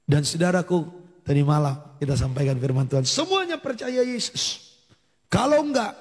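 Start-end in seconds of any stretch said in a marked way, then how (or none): 3.0-5.9 high-pitched preacher's urging; emphatic preaching, pitched well up; fervent exhortation. Real, not acted. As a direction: urge the congregation with raised pitch. Preacher urges belief.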